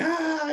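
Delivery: high, mock crying wail; wife imitating his crying in his sleep, high mock wail; imitated sleep cry. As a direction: mimic a whimper from a nightmare.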